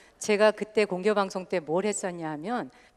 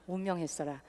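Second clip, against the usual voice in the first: softer, lower Jeolla repeat; Jeolla dialect repeated; 3 semitones lower, 12 dB quieter than usual. Shown as a demonstration. Host repeats the Jeolla phrase for the audience, softer. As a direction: repeat 운명했어라 quietly in Jeolla dialect, falling at the end. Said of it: "전라도에서는".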